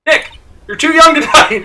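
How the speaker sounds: high, loud wailing plea; frantic. Acted. Maya calling over Nick after the tiger attack, high frantic cry. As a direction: cry out over a fallen friend.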